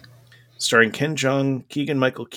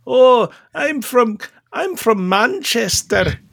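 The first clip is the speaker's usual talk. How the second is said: put-on Mancunian accent; 6 semitones higher, 3 dB louder than usual. Playing a Mancunian character.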